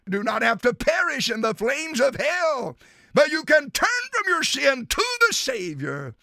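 ordinary talk, nothing marked

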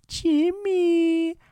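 very high, drawn-out cartoon call; Carl Weezer. Cartoon kid calling his friend, high squeaky voice. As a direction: squeak out the name, stretched long. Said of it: "Carl Weezer or something".

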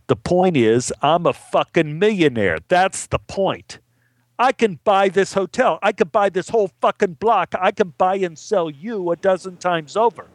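high-pitched acted outburst; indignant boasting. Millionaire gambler putting down an actor, indignant boasting. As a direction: build into a heated, swaggering boast.

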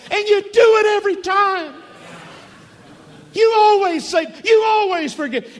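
high, loud, indignant complaint flung at God; exasperated shout. Acted. Jonah shouting his complaint at the Lord, indignant. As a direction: hurl the accusation upward, fed up and shouting.

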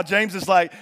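ordinary delivery

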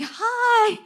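high, bright-toned overly cheery hi; chirpy hi. Retold or imitated. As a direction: sing out a friendly hello to a stranger. Greeting a stranger from big car.

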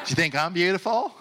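high-pitched, hammed-up hopeful question; mock needy stranger. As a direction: imitate someone fishing for compliments. Mocking listeners at gas stations.

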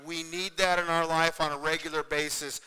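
ordinary talk

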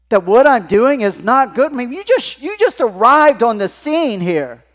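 loud, high, acted indignation; indignant outburst. Acts Moses indignant at father-in-law.

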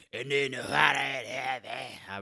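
slow, loud put-on shout; character voice. Shown as a demonstration. Comic shows his school reading voice, slow and loud. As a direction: bellow it in a big character voice. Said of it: "I like that voice".

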